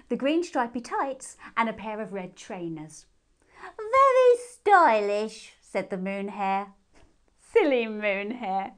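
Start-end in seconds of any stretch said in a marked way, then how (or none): 3.8-5.6 very high, loud and slow; vain hare. A narrator's reading. 7.4-8.7 put-on teasing child. A narrator's reading.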